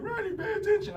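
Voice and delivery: high, loud screeched reprimand; mock scolding teacher. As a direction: parody a teacher shrieking for attention. Imitates teacher scolding inattentive student.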